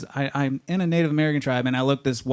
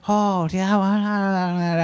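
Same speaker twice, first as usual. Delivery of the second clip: higher, gibberish imitation of Chinese; mock Chinese speech; 6 semitones higher, much slower than usual. Counter lady greets him unintelligibly, mock Chinese speech. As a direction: babble in caricature Chinese tones. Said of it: "She'd say something, I couldn't understand her".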